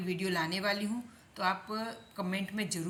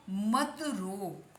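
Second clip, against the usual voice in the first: drawn-out reading for learners; slow model; much slower than usual. Shown as a demonstration. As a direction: stretch each word slowly. Teacher slowly reads out example phrase. (धीरे-धीरे खींचकर पढ़ते हुए)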